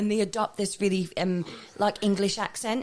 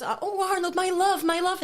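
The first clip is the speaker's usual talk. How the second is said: put-on higher, lovestruck girlish voice; wee lassie swooning; 8 semitones higher than usual. Quoting the Hey Arnold girl, lovestruck and higher. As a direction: sigh it out like a smitten girl. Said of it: "when the wee lassie goes".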